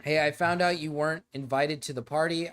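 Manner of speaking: usual speaking voice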